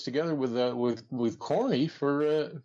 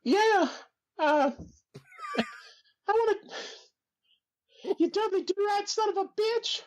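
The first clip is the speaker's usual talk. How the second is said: very high, thin squeaky voice; Jim Cornette impression. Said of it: "this is my Jim Cornette".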